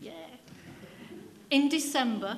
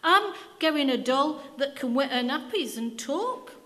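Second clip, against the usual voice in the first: put-on high, loud, eager little girl; a large Yorkshire girl calling out her wish; 7 semitones higher, 9 dB louder than usual. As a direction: rattle off the wish like an excited girl. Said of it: "added a large girl".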